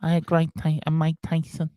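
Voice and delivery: squeaky Mike Tyson impression; squeaky voice with a Mike Tyson lisp. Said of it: "you did a squeaky voice like that".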